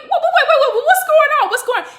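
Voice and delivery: high, loud panicked protest; mock panic. Suspect being handcuffed asks the cop why, mock panic. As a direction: stammer it out in comic alarm.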